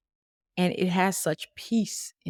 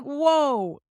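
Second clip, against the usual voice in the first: amazed woah; 8 semitones higher, 5 dB louder than usual. Retold or imitated. Reacting to both hearing God.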